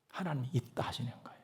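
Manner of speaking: breathy hush of God's answer; soft whisper. Acted. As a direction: whisper it as God's gentle reply. God's reply voiced softly.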